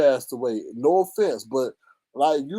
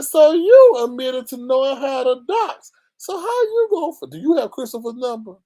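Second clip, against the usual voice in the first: accusing quote; 9 semitones higher, 6 dB louder than usual. Retold or imitated. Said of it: "I said".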